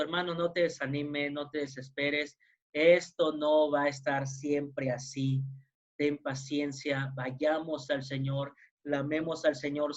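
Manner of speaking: tender pastoral encouragement; gentle reassurance. Real, not acted. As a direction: comfort the discouraged gently, unhurried. Pastor comforting discouraged listeners.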